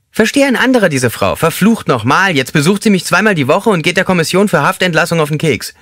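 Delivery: high, squeaky, exasperated man; squeaky inmate grumbling about his wife. A narrator's reading. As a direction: squeak out the complaint in disbelief.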